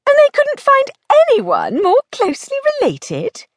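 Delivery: high-pitched, incredulous question; disbelieving. Acted. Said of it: "she asked disbelievingly".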